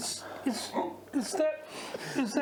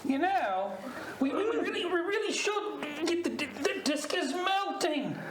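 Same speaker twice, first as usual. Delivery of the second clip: higher-pitched Australian alarm; Aussie accent; 5 semitones higher than usual. Acted. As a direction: play a worried Aussie, pitched up. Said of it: "Keep the accent if you like".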